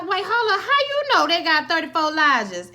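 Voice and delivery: high, laughing disbelief; a lightly voiced Atticus, laughing in disbelief; surprised Atticus. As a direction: question it with an amused squeak. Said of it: "And Atticus is like".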